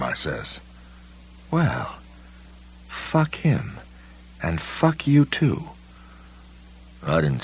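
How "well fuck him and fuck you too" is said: shrill, slow dismissive cursing; high contemptuous curse. A narrator's reading. Monster woman curses.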